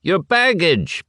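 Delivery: high, slow call after someone; a narrator's lightly voiced shout. A narrator's reading. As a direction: shout the reminder after him. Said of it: "shouted after him".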